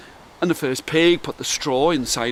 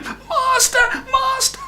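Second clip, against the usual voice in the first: shrill high-pitched cry, much higher; harp screaming; slower than usual. A narrator's reading. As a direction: shriek for help like a tiny harp. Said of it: "the harp was screaming".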